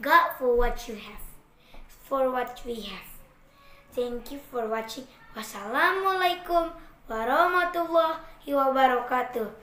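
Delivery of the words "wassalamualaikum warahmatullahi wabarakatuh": cheerful sign-off call. Real, not acted. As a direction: call goodbye out to everyone. Calling goodbye to the viewers.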